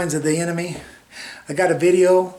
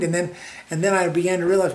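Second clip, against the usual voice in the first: usual speaking voice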